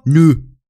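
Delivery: Nu said short and loud; clipped Saxon Nu for yes. Shown as a demonstration. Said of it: "zwei verschiedene Arten, Ja zu sagen".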